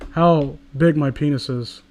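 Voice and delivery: ordinary talk, nothing marked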